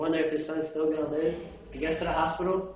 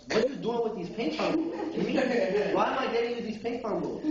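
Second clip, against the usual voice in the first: higher-pitched, mildly exasperated questioning; baffled dad; 6 semitones higher than usual. Acted. Dad demanding answers from Jimmy about the ping pong balls, baffled. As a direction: play a dad puzzled by his son's request.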